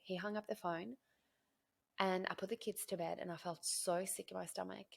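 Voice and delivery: ordinary talk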